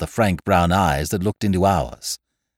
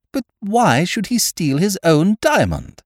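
much higher, baffled question; puzzled; 10 semitones higher, 4 dB louder than usual. A narrator's reading. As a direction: ask it in real perplexity. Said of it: "I asked, puzzled".

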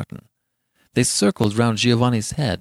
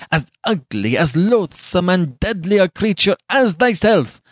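raised, emphatic tirade; furious passion; 7 semitones higher, 5 dB louder than usual. A narrator's reading. Giovanni blaming Beatrice for poisoning him, beside himself. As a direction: accuse her in a mounting, impassioned rage. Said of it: "beside himself with passion".

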